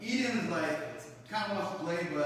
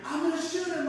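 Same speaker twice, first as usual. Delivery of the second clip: higher, a bit louder angry threat; mock yell; 11 semitones higher, 4 dB louder than usual. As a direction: imitate the neighbor yelling a threat. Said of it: "the guy's just screaming".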